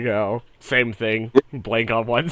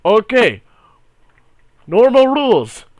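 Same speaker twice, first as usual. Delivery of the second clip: booming announcer; 8 semitones higher, 4 dB louder than usual. Acted. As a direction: proclaim it like a tournament herald. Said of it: "he's like".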